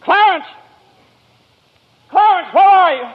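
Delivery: loud, high desperate call. Acted. George shouting for his angel on the bridge. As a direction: shout into the night for him.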